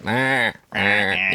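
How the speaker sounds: slow drawn-out crow-like "Ah, ah"; crow caw. Shown as a demonstration. Showing how Aussies sound like crows, slow cawing. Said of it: "we sound like crows".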